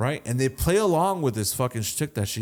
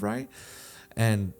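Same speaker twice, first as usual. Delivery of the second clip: ordinary talk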